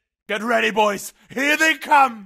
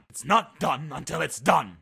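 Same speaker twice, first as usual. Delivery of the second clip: ordinary delivery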